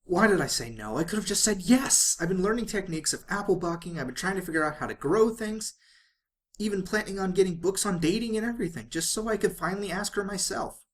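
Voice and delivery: put-on a young mare kicking herself for saying no; lovesick pony's lament. Inner monologue of lovesick pony.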